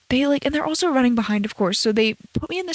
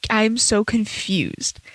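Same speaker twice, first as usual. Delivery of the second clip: drawn-out, dazed and slow; slow confused; much slower than usual. Retold or imitated. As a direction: say it slowly, half dazed. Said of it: "I was like".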